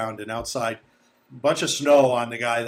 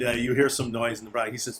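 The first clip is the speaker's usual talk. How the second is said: usual speaking voice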